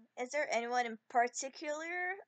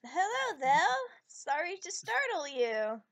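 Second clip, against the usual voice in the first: loud friendly greeting; 3 semitones higher, 6 dB louder, slower than usual. Acted. Character greets startled servants.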